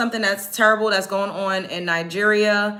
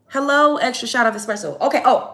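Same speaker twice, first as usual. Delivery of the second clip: higher and louder, caffeinated jolt; sudden hyped burst; 4 semitones higher, 4 dB louder than usual. Real, not acted. Vlogger jolting herself awake on camera, hyped. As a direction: snap up like an espresso just hit.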